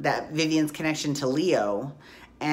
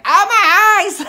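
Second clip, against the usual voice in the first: much higher and louder squeal, laughing; mock horrified Victor; 12 dB louder than usual. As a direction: shriek it like Victor seeing her naked. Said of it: "he's like".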